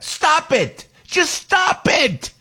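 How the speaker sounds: high, exasperated shout of stop it; a real outburst, pitch way up. Real, not acted. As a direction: yell it out, fed up and high. Ranting at killers' trial demands.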